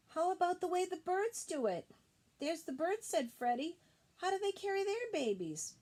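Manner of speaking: put-on high, eager little boy voice; high child voice. Little Freddie asking his mother, high and eager. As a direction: pitch it up as a young kangaroo.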